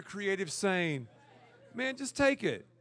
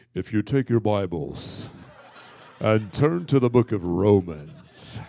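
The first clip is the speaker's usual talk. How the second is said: much lower, louder, slow pulpit voice; preacher stage voice; 10 semitones lower, 8 dB louder, slower than usual. Retold or imitated. Mocking a preacher who switches to stage voice, deep boom. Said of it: "preachers change their voices".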